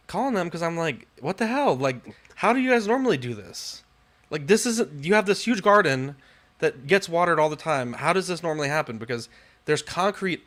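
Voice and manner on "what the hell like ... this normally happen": high-pitched, baffled complaint; exasperated questioning. Retold or imitated. Guy retelling his call to the homeowners about the hose, exasperated. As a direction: demand an explanation, voice pitched up.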